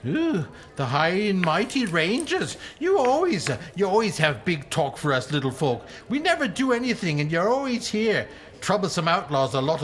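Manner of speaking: high squeaky voice, mocking the rangers; a squeaky little rogue sneering at the big folk; halfling voice, sneering. Halfling GM voice again.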